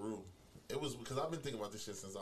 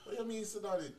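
startled confusion; 13 semitones higher than usual. Real, not acted. Caught off guard by the host's question, a high startled protest. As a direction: jump in, thrown by the question.

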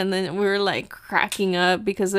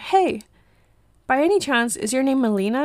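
lightly voiced fan, pitched higher; imitated eager stranger; 4 semitones higher than usual. Stranger checks if it is really her, imitated eager greeting. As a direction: mimic someone approaching hopefully.

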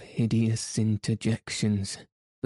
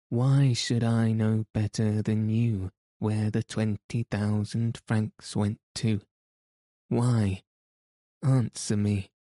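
plain reading voice

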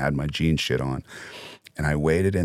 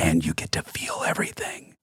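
whispering; 6 dB quieter than usual. Real, not acted. Whispered advice to son.

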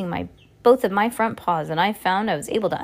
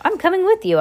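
put-on high boy voice, talking through banana; Bruno, mouth full; 11 semitones higher, 4 dB louder than usual. A narrator's reading. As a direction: pipe up greedily with a full mouth. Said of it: "speaking with his mouth full of banana".

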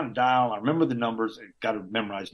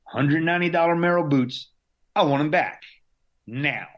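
loud, higher demand for his boots; prick demanding; 5 semitones higher, 5 dB louder than usual. Retold or imitated. Patient demanding his expensive boots from staff, loud and petulant. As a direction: insist on it like a spoiled jerk. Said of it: "it's just me being a prick".

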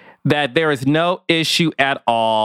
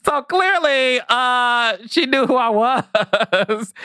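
high-pitched, drawn out through real laughter; laughing while talking; 9 semitones higher, slower than usual. Real, not acted. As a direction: talk through a fit of giggles. Cracking up at own remark.

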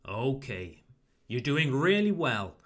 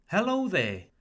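"Hello there" with a high, loud HELLO falling away; warm greeting intonation; 4 semitones higher, 4 dB louder than usual. Shown as a demonstration. English teacher models a greeting for learners, warm intonation. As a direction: greet warmly, "Hello there" starting high then dropping. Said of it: "Try to use my intonation".

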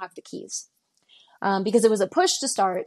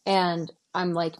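ordinary talk, nothing marked

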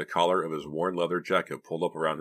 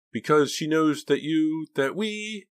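high, slow, fearful trailing question; faltering question; 12 semitones higher, 6 dB louder, slower than usual. A narrator's reading. Frightened boy cannot finish his question, faltering. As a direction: break off as dread takes over. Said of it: "Mickey couldn't finish his question".

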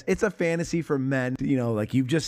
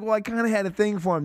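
imitating the wife; 6 semitones higher, faster than usual. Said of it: "And she's like".

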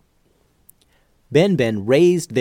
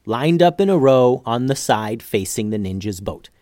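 ordinary delivery

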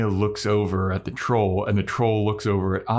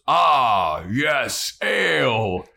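booming troll relish, a bit raised; 3 semitones higher, 3 dB louder, much slower than usual. Retold or imitated. Troll spotting kegs on a dolly, booming troll voice. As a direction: savour each word as a troll, loud on the first. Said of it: "the troll stops him and he goes".

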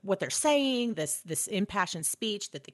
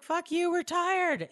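high, slow, whining complaint; whiny refusal; 12 semitones higher, slower than usual. Retold or imitated. Soldiers whining back at Gandalf, high and slow. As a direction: moan it like worn-out soldiers.

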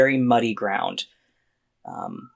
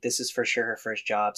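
usual speaking voice

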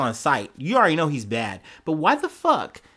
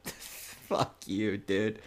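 under the breath, much softer; whispered aside; 5 semitones lower, 10 dB quieter, much slower than usual. Real, not acted. Host whispers a retort to Jacques, much softer. Muttered aside after the quote.

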